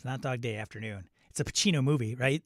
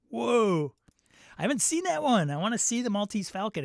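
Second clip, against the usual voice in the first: excited; 8 semitones higher than usual. Real, not acted. Excited about the movie.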